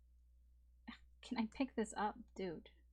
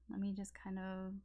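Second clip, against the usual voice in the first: whispered to self; 9 dB quieter than usual. Real, not acted. Muttering to herself while organizing.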